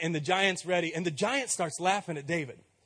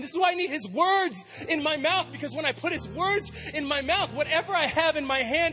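full-force preaching; 8 semitones higher than usual. Acted. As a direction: build the congregation up with fire.

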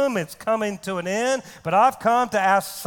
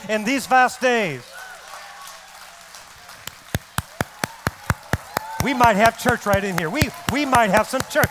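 forceful, raised sermon delivery; fervent preaching; 5 semitones higher, 4 dB louder than usual. Acted. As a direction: exhort the congregation with rising fervour.

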